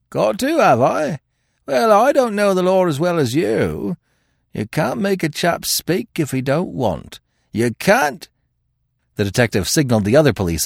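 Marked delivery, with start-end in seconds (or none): 0.1-8.3 high, drawn-out Cockney defiance; cocky, stubborn crook; defiant. A narrator's reading. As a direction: challenge the detective, voice raised. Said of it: "cried the prisoner in defiance".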